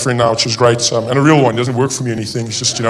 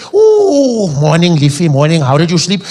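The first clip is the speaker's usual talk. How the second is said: put-on old Afrikaans husband; 7 dB louder, slower than usual. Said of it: "Old Afrikaans couples waking up".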